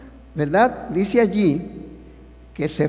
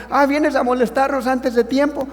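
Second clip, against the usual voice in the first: high, mocking complaint of the demons; 8 semitones higher, 3 dB louder than usual. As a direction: sneer it as the demons. Demonios reclaman a Jesús. (los demonios reclaman, agudo y burlón)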